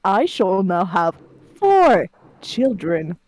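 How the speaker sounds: loud, slow, pompous announcement; hammed-up lordly decree; mock proclamation. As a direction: declare it grandly, drawing out each word.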